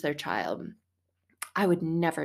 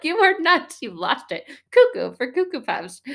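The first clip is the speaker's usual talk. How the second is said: much higher incredulous boss voice; 8 semitones higher, 7 dB louder than usual. Retold or imitated. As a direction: play the earthly boss who thinks you've lost it.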